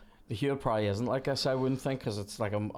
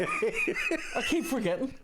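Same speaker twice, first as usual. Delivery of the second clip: slow words squeezed through laughter; real giggling confession; laughing; much slower than usual. Real, not acted. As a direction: laugh helplessly at yourself, high. Laughing at own repeated outburst.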